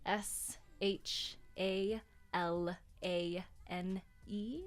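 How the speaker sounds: usual speaking voice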